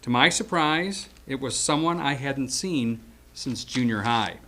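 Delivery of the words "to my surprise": ordinary talk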